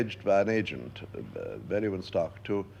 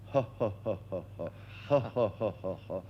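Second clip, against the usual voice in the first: measured, separate ha's falling away at the end; laugh exercise; 4 dB quieter than usual. Shown as a demonstration. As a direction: practise a stage laugh mechanically, one ha at a time. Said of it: "Now, start with this".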